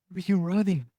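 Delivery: high, puzzled, imitated; bewildered buddy. Buddy asking his hurt friend why he was running, high puzzled.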